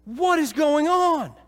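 drawn out, high, astonished question; amazed crowd. Retold or imitated. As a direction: act out the bewildered crowd in the square. Crowd baffled by the tongues.

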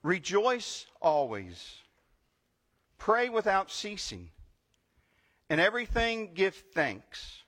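scripture reading. Acted. Pastor reading the verses aloud, solemn proclamation. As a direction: proclaim each line as God's word.

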